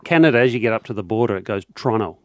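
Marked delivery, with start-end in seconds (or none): 1.4-2.2 Toronto with the middle t dropped, Toronno; Canadian Toronto. Shown as a demonstration. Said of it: "They don't pronounce the first O".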